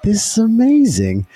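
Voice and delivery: high-pitched, amazed exclamation; excited quote. Retold or imitated. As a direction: relive the thrill, pitch leaping up. Acting out his delighted reaction.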